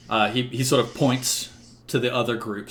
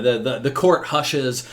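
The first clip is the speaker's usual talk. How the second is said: ordinary delivery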